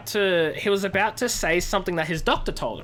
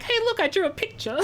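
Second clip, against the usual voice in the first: much higher, giddy show-and-tell voice; playful Johnny Depp; 14 semitones higher, faster than usual. Retold or imitated. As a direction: show off proudly in a high, laughing voice. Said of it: "Johnny Depp's just like".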